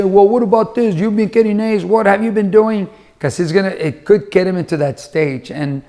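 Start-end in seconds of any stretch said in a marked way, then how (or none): 0.0-2.9 mock parental interrogation; critical questioning. Parent confronting a child over grades, critical questioning.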